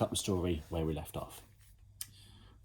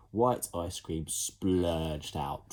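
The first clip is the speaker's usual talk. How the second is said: ordinary delivery, nothing marked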